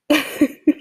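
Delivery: brief high awkward giggle; short giggle. Real, not acted. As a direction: let out a little laugh. Awkward laugh mid-message.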